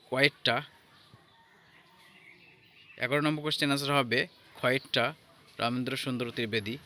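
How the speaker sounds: normal talking voice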